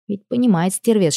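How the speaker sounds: ordinary delivery, nothing marked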